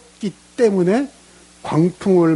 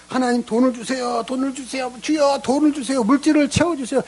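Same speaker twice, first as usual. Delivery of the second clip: mock begging prayer; 8 semitones higher than usual.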